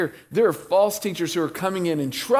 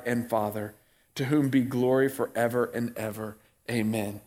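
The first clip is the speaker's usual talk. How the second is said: scripture reading; 5 semitones lower than usual. Acted. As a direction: read it reverently, letting it fade. Reads scripture slowly.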